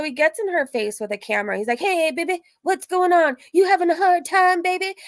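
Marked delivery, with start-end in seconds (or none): 1.8-4.9 high mocking come-on; imitated catcaller. Catcaller with camera to crying woman, high mocking imitation. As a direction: put on his pushy pick-up voice, pitched way up. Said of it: "that's how he talks to women".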